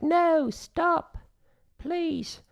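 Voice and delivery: put-on very high, begging British accent; pleading British accent. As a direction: beg like a posh British hand. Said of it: "Why do my hands have a British accent?".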